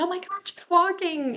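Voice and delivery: high, delighted disbelief; amazed mom. Retold or imitated. Mom seeing her dog walk at last, high amazed voice.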